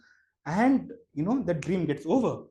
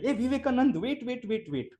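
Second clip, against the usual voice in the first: calling out high from behind; a high calling shout, half-acted; retold shout; 8 semitones higher than usual. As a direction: call his name across the gate to stop him. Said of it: "someone shouts my name from behind".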